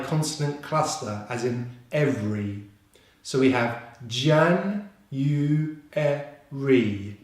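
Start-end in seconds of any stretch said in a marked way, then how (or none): 4.1-7.2 "jan, u, e, ri" in separated syllables. Shown as a demonstration. Teacher breaking January down for learners, syllable by syllable. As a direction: sound out "jan, u, e, ri" piece by piece. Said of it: "with the stress on the first syllable".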